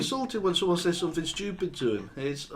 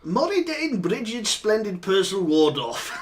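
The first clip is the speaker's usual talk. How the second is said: posh voice; 3 semitones higher, slower than usual. Acted. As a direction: read the names out as poshly as you can. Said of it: "the most posh voice you can".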